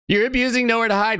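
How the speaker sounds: sneering whiny imitation; mocking the whiner. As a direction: parrot the crybaby's accusation. Mock-quoting the salty player.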